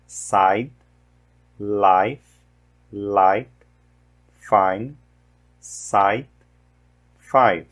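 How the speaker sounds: "Side", "life", "five" with the vowel of "eye"; teacher modelling the long I in "light" and "fine"; English long I words. Shown as a demonstration. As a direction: model each word slowly, saying the I like "eye". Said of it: "o I tem som de I".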